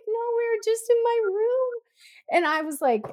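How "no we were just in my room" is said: put-on very high little-boy voice; crying child. Son excusing himself to mom, high childlike protest. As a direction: plead innocence in a high mock-child voice. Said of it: "he comes out crying and he's like".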